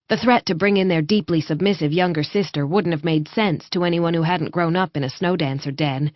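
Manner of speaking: usual speaking voice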